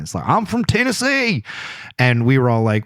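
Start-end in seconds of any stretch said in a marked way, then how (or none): 0.3-1.4 high-pitched mock Southern twang; thick Southern accent. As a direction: imitate a proud Tennessee Southerner, pitched way up. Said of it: "thick Southern accent".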